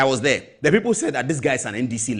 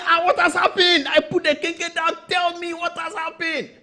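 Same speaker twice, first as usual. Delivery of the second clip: much higher, startled demand; alarmed. Retold or imitated. Host hears the news and demands answers, alarmed. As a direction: jump up in alarm. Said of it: "I said".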